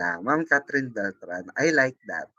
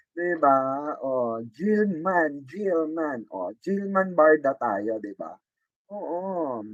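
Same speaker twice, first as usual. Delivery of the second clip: ordinary talk, nothing marked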